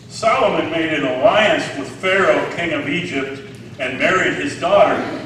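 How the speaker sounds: ordinary talk, nothing marked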